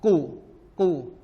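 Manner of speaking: emphatic qaf drill; heavy qaf. Shown as a demonstration. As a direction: raise the back of the tongue for a thick "ku". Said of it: "ada bunyi isti'la".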